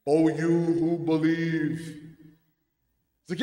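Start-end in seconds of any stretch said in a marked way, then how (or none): 0.1-1.8 slow, sung, echoing divine voice; God's voice. Shown as a demonstration. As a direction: intone it like a voice from the heavens. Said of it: "How do you want me to find God's voice?".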